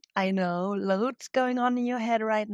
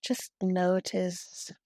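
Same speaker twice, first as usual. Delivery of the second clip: guided meditation. Real, not acted. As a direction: guide them gently into breathing, unhurried. Leads a heart-centred yoga meditation.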